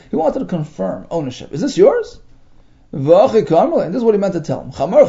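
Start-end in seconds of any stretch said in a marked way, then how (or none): 1.5-2.2 high, rising on yours; questioning tune. Shown as a demonstration. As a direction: ask it, just confirming. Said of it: "It's like a question mark".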